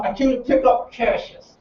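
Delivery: clenched, numb-mouthed request; through gritted teeth. Retold or imitated. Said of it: "I'm gritting my teeth and I'm talking like that".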